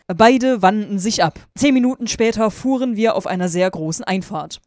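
story narration. A narrator's reading. Reading fan fiction aloud to viewers, emphatic narration. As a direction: read it out with loud, deliberate emphasis.

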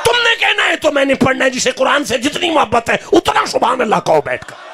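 high-pitched, insistent sermon voice; fired-up preaching. Real, not acted. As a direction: preach it high and defiant, pressing the crowd. Preaches with raised voice.

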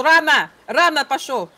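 loud sharp call; shouting. Real, not acted. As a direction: shout him back, loud. Calling after wandering game character.

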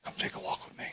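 whispered invitation. Retold or imitated. Yeshua inviting Peter aside, whispered. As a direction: whisper it gently. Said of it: "I think Yeshua said to Peter".

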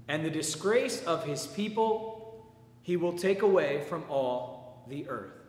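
proclaiming, then slowing and fading toward the end; solemn scripture reading. Acted. Reads Isaiah aloud in church.

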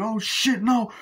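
high, loud frantic protest; mock terror under fire; panicked cry. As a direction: plead in terror as bullets fly. Max nearly getting shot.

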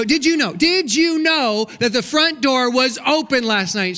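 accusing question to wife; pointed question. Retold or imitated. Confronting wife about open door.

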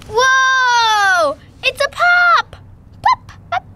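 high, loud excited squeal; thrilled squeal of delight. Real, not acted. Excited surprise at a toy.

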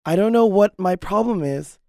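ordinary delivery

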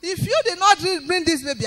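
loud demand at the doctor; retold shout. Mother threatens the doctor for her baby, loud retold shout. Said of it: "I shouted".

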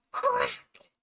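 sharp high gasp then a loud crack. Acted. Narrator voicing the branch snapping, high startled gasp.

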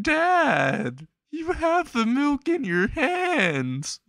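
hammed-up wail, very high and drawn out; mock-sobbing child wailing after Dad; crying. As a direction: cry it out slowly in a squeaky high voice. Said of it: "Izuku starts crying".

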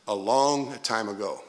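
ordinary delivery

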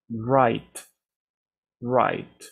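right, right with a clear final t; teacher's model. Shown as a demonstration. Teacher repeats right for students, final t clear. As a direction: repeat right, ending sharply on t. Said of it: "suena de esta manera".

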